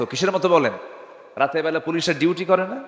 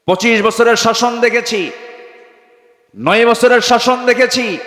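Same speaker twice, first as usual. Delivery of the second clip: high, loud, chanted litany; rousing preaching; 10 semitones higher, 7 dB louder than usual. Real, not acted. Preacher lists past governments to the crowd, rousing high cry. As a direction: call out each phrase at full voice, pausing between.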